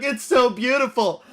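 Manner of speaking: mock him choking up with awe; a hammed-up overwhelmed fan. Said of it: "he starts literally bawling".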